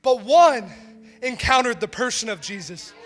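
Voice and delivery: ordinary talk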